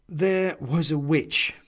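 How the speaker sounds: high, slow reminder; insistent Bobbs. A narrator's reading. Bobbs reminds Benedict of the witch, slow and insistent.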